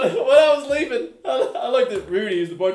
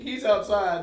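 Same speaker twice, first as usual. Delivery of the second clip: usual speaking voice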